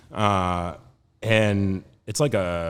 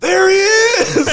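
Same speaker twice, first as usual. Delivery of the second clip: drawn-out, high, very loud holler; 10 dB louder, much slower than usual. Acted. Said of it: "and he screams".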